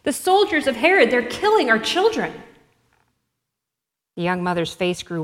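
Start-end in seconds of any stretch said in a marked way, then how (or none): 0.0-2.3 rising to a higher, louder panicked cry; desperate cry. Acted. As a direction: shout the alarm in growing terror, higher each time. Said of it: "a desperate cry".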